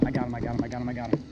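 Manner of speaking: ordinary talk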